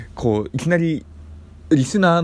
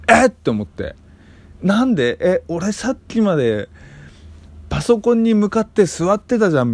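surprised; 8 semitones higher than usual. Retold or imitated. Startled by lost time.

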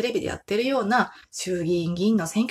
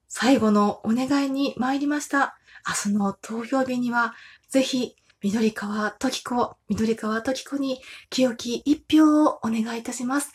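campaign car announcer; 4 semitones higher than usual. Retold or imitated. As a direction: plead for votes like an uguisu-jo. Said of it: "みたいな感じでね". (選挙カーのウグイス嬢風の呼びかけ)

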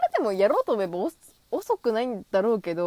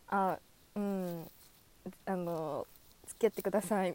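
shy acceptance; 2 semitones lower, 8 dB quieter than usual. Pretend. Shyly accepting a confession in fantasy.

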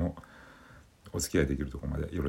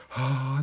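high breathy surprised gasp; startled gasp; 10 semitones higher, 5 dB louder than usual. Retold or imitated. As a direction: gasp like something startled you. Gasp said to phone.

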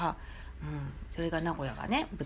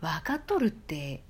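slow whispered Nagoya わかっとるって; more natural Nagoya dialect; 3 semitones lower, slower than usual. Shown as a demonstration. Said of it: "は言うかな".

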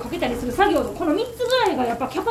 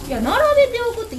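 higher, louder, fast puzzled repeat; puzzled echo; 9 semitones higher, 4 dB louder, faster than usual. Retold or imitated. Shop owner repeating the odd order back to herself, bewildered. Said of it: "一個ずつ置くんかと思って".

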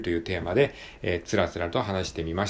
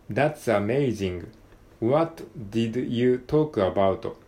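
slow katakana English with added vowels; deliberately heavy Japanese accent, word by word; slower than usual. Shown as a demonstration. Learner reading a textbook page in deliberately exaggerated Japanese-accented English. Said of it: "英語っぽく喋る".

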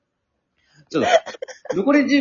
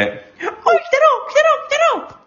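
high startled yelps; shrieking surprise. Real, not acted. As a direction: squeal in shock.

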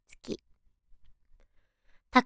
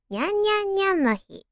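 high cute meowing nyan nyan nyan; cute cat voice; 7 semitones higher, 4 dB quieter, faster than usual. Pretend. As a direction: purr it out like a kitten. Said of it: "地声がアニメ声の". (高い猫なで声のにゃんにゃん)

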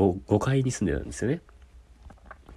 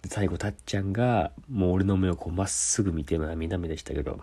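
ordinary talk, nothing marked

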